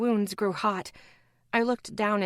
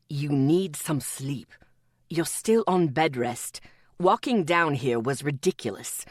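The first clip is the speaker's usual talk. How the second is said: low gentle scolding; 6 semitones lower than usual. A narrator's reading. Atticus scolding Evelyn gently.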